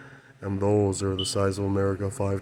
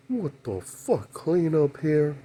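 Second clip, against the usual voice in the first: quoted disbelief; 7 semitones higher, slower than usual. Retold or imitated. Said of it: "He says".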